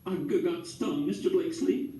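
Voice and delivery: high, halting, slow sob; lightly sobbing lady, slowed and broken. A narrator's reading. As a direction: stammer through tears. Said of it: "she sobbed".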